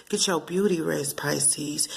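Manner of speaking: whispered beauty-rest advice. Real, not acted. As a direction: breathe it softly, like a bedtime hush.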